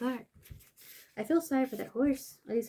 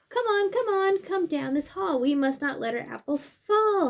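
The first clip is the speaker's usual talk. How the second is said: higher, eager character voice; storybook animal voice; 6 semitones higher, 5 dB louder than usual. Acted. Animal urging friends down the hall, high and eager. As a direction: urge your friends along, bright and high.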